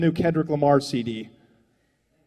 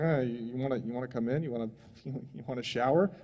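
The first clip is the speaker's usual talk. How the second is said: lower, much softer and timid; hesitant mumble; 5 semitones lower, 11 dB quieter than usual. Retold or imitated. Indians answering the filthy settlers, softly hesitant. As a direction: shrink back, unsure.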